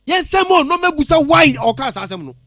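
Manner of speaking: high-pitched, indignant outburst; real agitated outrage. Real, not acted. Caller demanding who the pastor thinks he is, agitated outrage. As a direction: fire off indignant questions, voice leaping up. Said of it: "I've been trying to calm this gentleman down".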